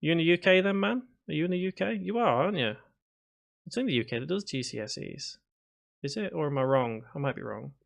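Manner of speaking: ordinary talk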